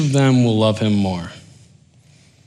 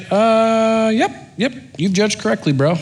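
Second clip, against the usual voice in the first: mock approval; 9 semitones higher than usual. Jesus humouring the Pharisee's answer, mock approval. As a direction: humour him with a comic high voice.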